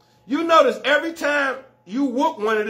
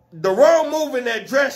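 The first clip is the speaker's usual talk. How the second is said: ordinary talk, nothing marked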